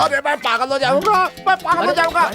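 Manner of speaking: very high-pitched frantic wailing; outraged meltdown, voice shooting up; hysterical exasperation. Acted. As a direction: rave as if driven mad. Headman storms off at wits' end.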